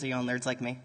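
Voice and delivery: usual speaking voice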